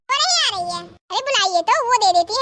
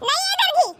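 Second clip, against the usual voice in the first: sharp refusal; 7 semitones higher than usual. Acted. Mother-in-law refuses neighbour.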